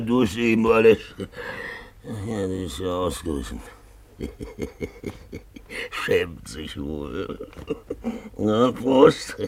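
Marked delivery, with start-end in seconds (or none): 4.2-5.8 quiet chuckle. Acted. Drunk man chuckles.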